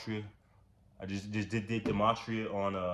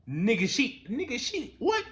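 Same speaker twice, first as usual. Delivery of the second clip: incredulous; 13 semitones higher than usual. Real, not acted. Disbelief at the headbutts.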